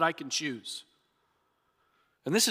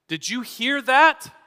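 higher, louder, slowed disbelief; incredulous; 8 semitones higher, 9 dB louder, slower than usual. Retold or imitated. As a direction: react as if you can't believe your ears. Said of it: "It's like saying".

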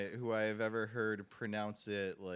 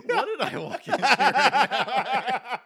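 laughing; 13 semitones higher, 14 dB louder, much slower than usual. Real, not acted. Guest laughing at host's opening joke.

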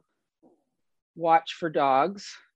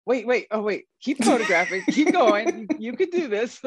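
high, laughing mock encouragement; giggling urge to keep going; laughing urging; 7 semitones higher than usual. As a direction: cheer them on while laughing. Urging dog team, laughing.

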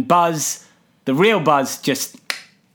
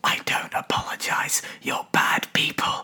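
whispered, unvoiced jab; whispered aside; 9 dB quieter than usual. Aside insulting horse girl listeners.

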